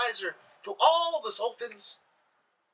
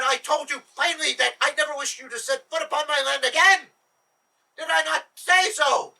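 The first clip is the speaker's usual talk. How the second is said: enraged shouting Sultan; 6 semitones higher, 6 dB louder than usual. Acted. Sultan enraged at Jabas again.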